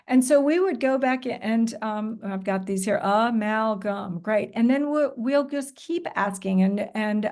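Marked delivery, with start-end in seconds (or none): 3.0-4.2 amalgam broken slowly into a, mal, gam; syllable by syllable. Shown as a demonstration. Teacher recapping the syllables for the class, syllable by syllable. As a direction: say amalgam as separate, drawn-out syllables. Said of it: "let's repeat the syllables in order".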